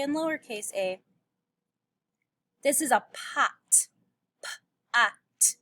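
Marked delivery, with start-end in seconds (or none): 4.4-5.6 "P-ot" split into onset and rhyme; sounding out. Shown as a demonstration. Teacher segmenting pot for pupils. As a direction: break "P-ot" apart sound by sound. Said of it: "Do you hear the a?".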